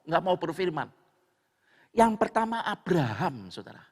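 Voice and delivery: normal talking voice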